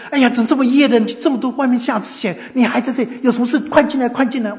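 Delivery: high, loud flustered welcome; startled concern. Retold or imitated. Host finding old man out in snow, startled concern. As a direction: exclaim in alarm and hurry them inside.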